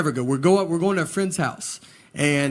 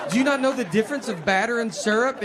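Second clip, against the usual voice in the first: exasperated manager; 6 semitones higher than usual. Retold or imitated. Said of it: "And the guy's like".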